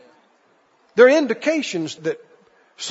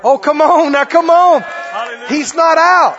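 loud, higher preacher cry; loud preacher insistence; rousing exclamation; 5 semitones higher, 6 dB louder than usual. Real, not acted. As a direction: stir up the church. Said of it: "preacher rousing the congregation".

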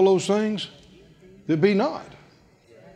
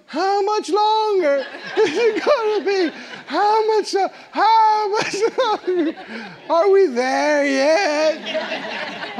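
put-on high, whining child's voice, laughing through it; mock child whine on a long car trip; whiny kids in back seat; 5 semitones higher than usual. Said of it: "decided to whine".

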